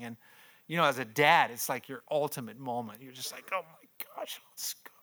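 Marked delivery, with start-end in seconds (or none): 3.5-4.9 soft, voiceless retold whisper; hushed imitation of a moved dad; overwhelmed whisper. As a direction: whisper it as if overcome. Overwhelmed dad's inner reaction, acted.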